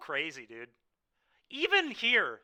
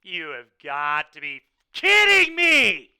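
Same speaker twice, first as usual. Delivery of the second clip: loud, slow, drawn-out cry of disbelief; real furious disbelief, much louder; exasperated yell; 14 dB louder than usual. Real, not acted. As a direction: yell it at the screen in real frustration. Streamer loses game to bad draws.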